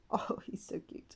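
whispered coo with a laugh; soft laughing whisper; giggling whisper. Real, not acted. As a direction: coo softly over the cute pet. Cooing over cute pet.

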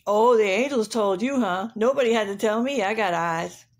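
rooster voice with a loud, mocking "Oh"; scoffing rooster. Rooster boasting to shepherds that nobody had to tell him, scoffing. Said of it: "The rooster said to the shepherds".

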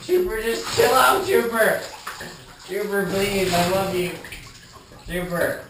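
high, loud begging; frantic pleading. Real, not acted. Owner begging the wet dog to calm down, loud and high. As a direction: plead loudly, rising over the splashing.

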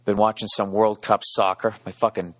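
ordinary talk

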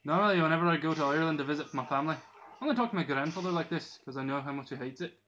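imitation Galway Irish accent; bad Irish accent. Shown as a demonstration. Gamer showing viewers his dad's Galway accent, bad Irish accent. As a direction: put on a hammy Irish brogue. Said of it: "a very bad Irish accent".

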